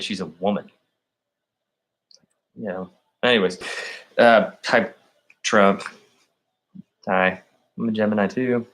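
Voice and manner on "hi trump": ordinary talk, nothing marked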